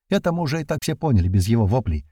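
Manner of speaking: usual speaking voice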